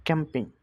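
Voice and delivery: "ক্যাম্পিং" starting with a k sound. Shown as a demonstration. Teacher giving an example of C, "ক্যাম্পিং" begun with k. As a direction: say "ক্যাম্পিং" with the C hard, as k. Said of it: "কর মতন হয়".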